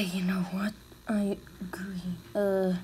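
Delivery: hushed unvoiced whisper; breathy secret whisper. Sudden whispering in play.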